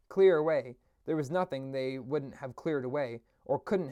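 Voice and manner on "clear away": loud call. A narrator's reading. Narrator echoes Fezziwig's call.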